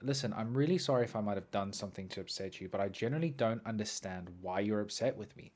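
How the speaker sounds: soft, low, quick apology; placating apology. Retold or imitated. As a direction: talk down an aggressor, quietly. Acts out his timid apology.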